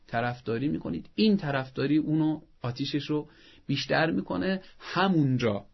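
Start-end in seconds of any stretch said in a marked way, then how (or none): none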